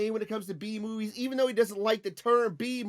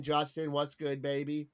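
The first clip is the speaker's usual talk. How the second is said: normal talking voice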